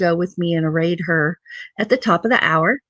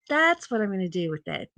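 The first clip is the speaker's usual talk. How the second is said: softer repeat, trailing off; quiet repeat; 3 semitones lower, 8 dB quieter, faster than usual. Shown as a demonstration. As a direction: say it again under your breath. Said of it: "So that's what I'm gonna do with it".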